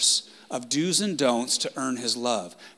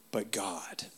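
dramatic whisper; 4 semitones lower, 10 dB quieter than usual. Acted. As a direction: whisper it softly, with weight. Sermon's key phrase, dramatic hush.

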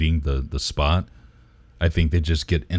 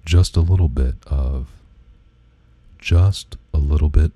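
ASMR voice; 2 semitones lower, 4 dB louder than usual. Shown as a demonstration. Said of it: "it'll be like".

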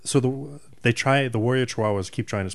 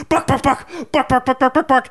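high squawking chicken clucks, much higher; sputtering foiled villain; 4 dB louder than usual. Retold or imitated. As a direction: cluck like a furious foiled cop. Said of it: "at the border going".

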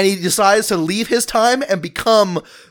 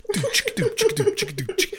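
high giggle; 13 semitones higher, 6 dB quieter than usual. Real, not acted. High laugh after his joke.

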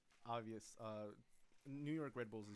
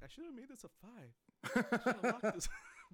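laughing; 9 semitones higher, 7 dB louder than usual. Retold or imitated. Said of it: "I'm like".

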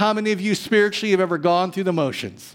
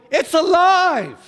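amazed cry; 10 semitones higher, 5 dB louder, slower than usual. Retold or imitated. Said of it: "they would go".

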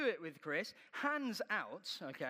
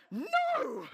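much higher, much louder shrieked refusal; panicked no; 14 semitones higher, 10 dB louder than usual. Retold or imitated. Preacher refusing to push harder on the bike, shrieked no. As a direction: yelp the refusal in horror. Refusing Chris's offer to step up.

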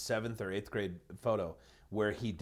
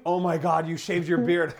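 loud, higher-pitched mock astonishment; retold shocked girlfriend; 5 semitones higher, 8 dB louder than usual. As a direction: exclaim it like a stunned girlfriend. Girlfriend discovers the shaved beard.

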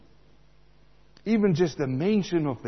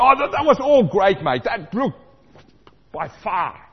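much higher, louder fake-enthusiastic praise; mock gushing praise; 6 semitones higher, 9 dB louder than usual. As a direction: gush like a fake-friendly mate. Imitating fake praise to rival.

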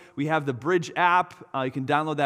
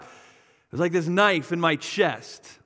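ordinary talk

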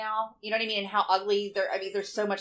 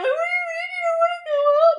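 high loud crying wail; mock crying; 8 dB louder than usual. Mom imitating herself crying over her son's text. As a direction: sob it out, half laughing. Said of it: "I was just, like, crying".

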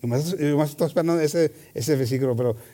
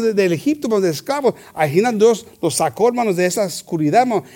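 ordinary talk

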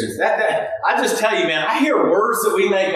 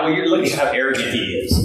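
sniffy mock disdain; 7 semitones lower, faster than usual. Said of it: "And you're like".

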